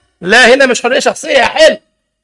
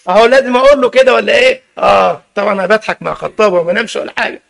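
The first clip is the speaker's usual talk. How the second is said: ordinary talk, nothing marked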